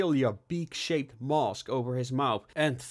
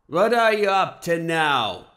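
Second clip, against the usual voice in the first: loud, slow, looming interrogation; heavy accusing voice from memory; heavy familiar voice; 5 semitones higher, 8 dB louder than usual. A narrator's reading. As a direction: boom it out slowly, pitched up. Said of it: "asked a heavy, familiar voice".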